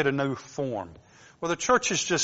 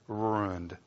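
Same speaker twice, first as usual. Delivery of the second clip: drawn-out North Georgia "ruined"; North Georgia accent; 5 semitones lower, 7 dB quieter than usual. Real, not acted. Preacher reading scripture to the church, North Georgia accent. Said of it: "That North Georgia accent".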